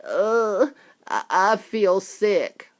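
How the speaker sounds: put-on slow, halting queasy girl; sickly girl. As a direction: falter out the words. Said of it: "Violet said".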